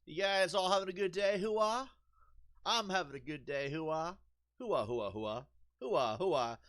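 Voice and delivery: high, loud, hammed-up mock soldier chat; mocking the game's hooah. Gamer mocking the game's soldiers, high loud parody. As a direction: ham up the silly small talk, sneering. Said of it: "those military officers are not military".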